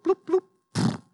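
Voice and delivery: high, playful bloop sound effect; cartoon bloop noises. The pastor mocking himself falling back in, high playful bloops. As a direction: bounce each bloop up high.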